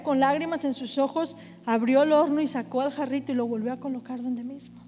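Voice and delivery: usual speaking voice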